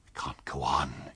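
slow, whispered mutter to himself. A narrator's reading. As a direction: breathe it out under his breath, slowly. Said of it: "he was muttering".

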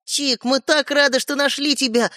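high, slow, moved voice; excited. A narrator's reading. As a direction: gush with relief at finding him. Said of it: "взволнованно проговорил Светлик". (взволнованно)

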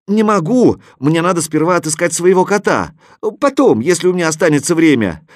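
put-on high, loud boyish protest; shouted refusal. A narrator's reading. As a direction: call back loudly that you cannot. Boy shouts refusal up to them.

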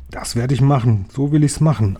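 ordinary delivery

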